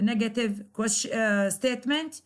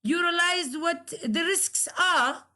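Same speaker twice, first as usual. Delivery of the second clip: higher-pitched statement-question, lifted on "risks are"; questioning tune that peaks on "are"; rising question tone; 5 semitones higher than usual. Shown as a demonstration. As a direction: raise "risks are" as if checking. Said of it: "you rise your tone".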